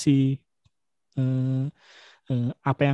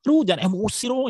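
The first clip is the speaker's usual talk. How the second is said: higher, punchy imitation of a Batak friend's blunt line; playful Batak accent imitation, pitched up; Batak-style jab; 7 semitones higher than usual. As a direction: tease like a blunt Batak buddy. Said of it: "masih ngeliat teman-teman Batak ya, kalau ngomong juga masih".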